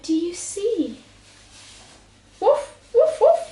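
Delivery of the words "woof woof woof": high loud woofs; playful high loud woof woof; dog barking. Acted. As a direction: bark like a little dog. Parent voicing dog.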